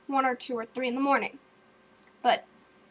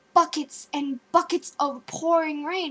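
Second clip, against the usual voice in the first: ordinary talk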